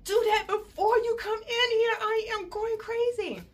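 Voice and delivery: high, mock-frantic scolding; high-pitched hammed-up impatience; exasperated demand. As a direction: act out being at your wits' end. Retold exasperation at the slow nurse.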